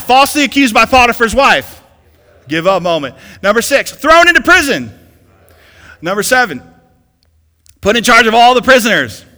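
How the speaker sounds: loud, high preacher's call; call-and-response preaching. Acted. Pastor leading the church to shout go on or give up, loud. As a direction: rouse the crowd to yell back.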